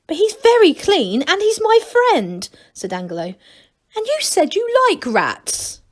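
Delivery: put-on high childlike pleading. Angelo begging Granny to keep his rat, high pleading.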